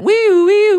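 high, loud squeaky wee; high announcement jingle. Acted. Cohost plays a squeaky announcement sound, high and loud. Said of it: "that's not the announcement sound".

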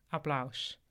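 ordinary delivery, nothing marked

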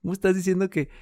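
ordinary talk, nothing marked

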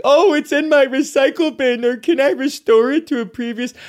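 high, put-on mocking voice; mock naive user. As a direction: jump way up in pitch to mock a clueless question. Mocks a naive user's quote.

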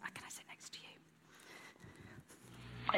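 soft whisper to someone beside her; hushed, slow aside. Real, not acted. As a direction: quietly ask for a seat, slowly. Aside while finding a seat.

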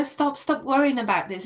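normal talking voice

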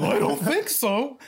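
high cartoonish reply; Shaggy and Scooby. Film fan voicing the other clerk, goofy high cartoon voice. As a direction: answer like a goofy cartoon sidekick. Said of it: "why are the clerks now Shaggy and Scooby".

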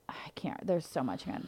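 faint breathless whisper, struggling; strained whisper. Real, not acted. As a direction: barely get the words out. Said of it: "I, like, could barely get that out".

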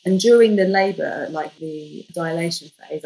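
ordinary delivery

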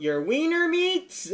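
very high, drawn-out mock holler; imitated heckler yell. Comic imitating a girl interrupting the joke, yelling out. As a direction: squeal it out loud like a heckler. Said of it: "yells out".

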